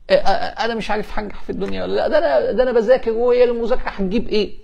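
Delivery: high-pitched fretting student; طالب قلقان. Acted. Voices a panicking student. (تقليد طالب محتار بصوت عالي الطبقة)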